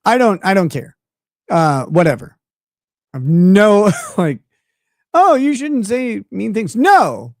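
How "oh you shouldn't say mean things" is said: mocking. Mocking a scold.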